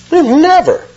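high, insistent protest on We've never; emphatic never. Retold or imitated. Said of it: "that's an emphatic negative there".

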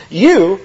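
"You" pitched high and held; emphatic YOU. Acted. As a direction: punch the pronoun "You". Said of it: "another emphatic pronoun".

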